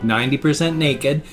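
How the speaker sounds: normal talking voice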